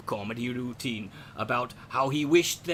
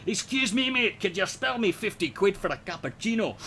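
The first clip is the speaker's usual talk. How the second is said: Glasgow accent; 5 semitones higher than usual. Shown as a demonstration. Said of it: "you got Glasgow".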